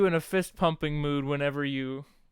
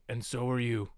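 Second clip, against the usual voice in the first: low, soft deadpan Drax; understated imitation of Drax, pitched down; Drax impression; 5 semitones lower, 6 dB quieter than usual. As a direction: lower the voice and pause before the last phrase. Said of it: "and he's like".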